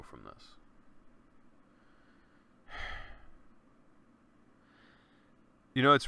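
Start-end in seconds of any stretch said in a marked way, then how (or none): 2.7-3.3 long unvoiced grieving sigh; a deep sigh before going on; heavy sigh. Real, not acted. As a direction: let out a weary breath of grief. Pause while talking about brother.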